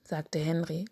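plain reading voice

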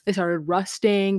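ordinary talk, nothing marked

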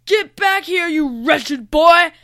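high, loud villain's screech; angry screech. A narrator's reading. Lane flying after the fleeing Noah, loud angry screech. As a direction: screech it, dragging out the last words. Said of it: "Lane screeched as he flew".

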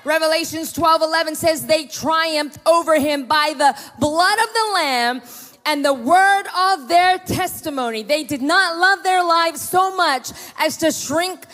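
rally the congregation to victory; preaching at full force. Acted. Preaching climax.

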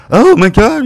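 loud, very high shriek; high-pitched panicked exclamation; mock outrage. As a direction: imitate a manager freaking out. Acting out the outraged manager.

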